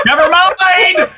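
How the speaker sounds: high, slow, laughing never mind; laughing shout. Real, not acted. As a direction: burst out laughing as you say it. Repeats never mind, then laughs.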